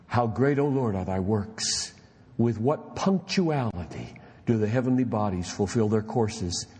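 put-on low, measured reading of an old diary; low reverent quoted reading; solemn reading. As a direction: read it out with hushed reverence. Reading Newton's diary aloud.

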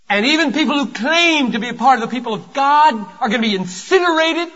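high, loud warning from the pulpit; forceful preaching. Real, not acted. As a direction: drive the judgment warning home at full force.

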